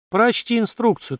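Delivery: slightly slow, offhand instruction, stress on прочти; casual command. A narrator's reading. Li brushing off the seller, offhand command. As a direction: tell him прочти инструкцию as if it is obvious. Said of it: "Ли небрежно ответил".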